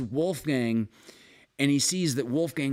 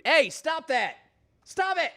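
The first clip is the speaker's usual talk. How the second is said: scolding shout; 12 semitones higher than usual. Real, not acted. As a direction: yell at the cat to quit. Scolding cat on mixer.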